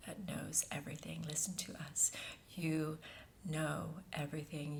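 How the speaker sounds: unvoiced hushed whisper. Channeled being addresses listeners in a hushed whisper. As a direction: breathe the words like a secret.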